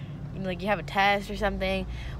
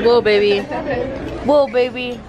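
loud, delighted whoa; excited exclaim; 10 dB louder than usual. Real, not acted. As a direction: cheer at the dessert. Excited about the dessert.

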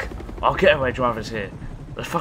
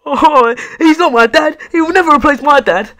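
put-on much higher, much louder whiny teen yell; mock Miles outburst; 12 dB louder than usual. Mocking Miles rejecting his mum's boyfriend, high loud yell. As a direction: wail it like a teen refusing a stepdad. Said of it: "already imagine Miles saying".

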